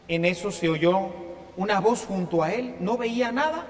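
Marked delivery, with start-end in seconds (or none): none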